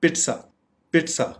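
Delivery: "पिट्सा" with crisp ts; correct pronunciation. Shown as a demonstration. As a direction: model "पिट्सा" as PIT-sa, separately. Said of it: "correct pronunciation है".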